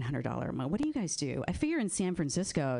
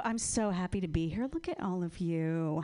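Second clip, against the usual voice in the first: ordinary talk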